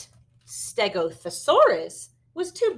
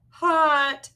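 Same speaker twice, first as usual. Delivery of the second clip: higher, louder "Hot"; higher echo; 8 semitones higher, 7 dB louder than usual. Acted. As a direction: echo your brother in a higher voice. Second dinosaur echoes higher.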